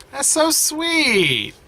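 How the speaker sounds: high, slow, melting coo; drawn-out aww at something sweet; high, slow, doting sigh of delight; gushing. Real, not acted. Gushing over the scene.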